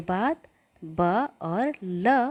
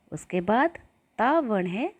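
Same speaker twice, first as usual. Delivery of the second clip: normal talking voice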